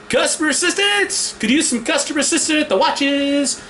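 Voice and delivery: high calling out for help. Retold or imitated. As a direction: call across the store for assistance. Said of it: "got a little louder".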